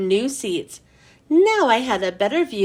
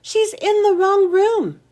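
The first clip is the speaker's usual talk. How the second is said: squeaked out loud; 8 semitones higher, 3 dB louder than usual. A narrator's reading. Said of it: "I squeaked out loud".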